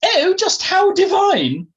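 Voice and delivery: very high, drawn-out upper-class squawk; Felicity Forsyth Twike. Acted. Posh lady gushing over her friend's new dog, squawking. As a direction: squawk it loudly like a snooty English aristocrat. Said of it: "squawked Felicity Forsyth Twike".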